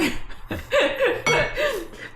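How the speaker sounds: squeaky burst of laughter; high giggling fit; high-pitched laugh. Real, not acted. Laughing at mispronunciation.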